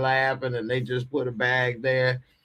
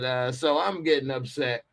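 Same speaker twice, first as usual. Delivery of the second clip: ordinary talk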